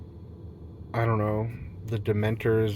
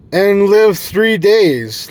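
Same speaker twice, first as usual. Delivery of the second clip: stretched slowly, higher and louder; grand dramatic finish; 8 semitones higher, 10 dB louder, much slower than usual. Pretend. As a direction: land it like a triumphant vow. Dramatic punchline of his plan.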